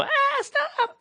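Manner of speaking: very high squeaky protest; girly squeal. Retold or imitated. Women squealing at being slapped. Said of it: "they always go".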